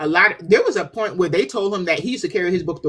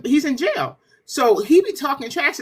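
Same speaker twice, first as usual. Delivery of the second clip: ordinary talk, nothing marked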